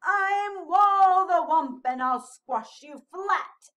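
loud, slow mock threat; playful scary monster. A narrator's reading. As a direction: act big and scary, drawing it out. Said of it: "trying to look big and scary".

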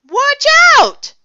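very high, loud warning; panicked high yell. A narrator's reading. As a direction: shout an urgent alarm. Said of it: "somebody yelled".

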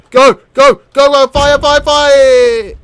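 high, loud, rapid-fire commands; urgent shouting. Real, not acted. Player urging the cannon to fire, urgent shouting. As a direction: shout repeatedly, stretching the last one.

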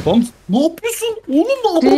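high mock angry voice; imitating the enemy. Husband mimics an enemy player scolding, high mock anger. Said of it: "şu adam diyor ki".

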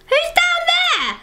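high, startled question; alarmed question. Real, not acted. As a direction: ask it suddenly alarmed. Everyone coming up.

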